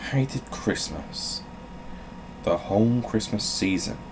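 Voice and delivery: storybook read-aloud voice; playful bedtime story narration; reading to children. Acted. Reads Grinch story aloud as bit.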